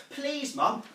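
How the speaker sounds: put-on high-pitched childish plea; child's pleading. Child asking mum for blackjacks, pleading. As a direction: beg mum for a treat.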